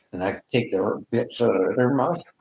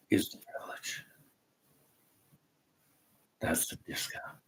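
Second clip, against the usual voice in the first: whispered aside; 3 semitones lower, 12 dB quieter than usual. Real, not acted.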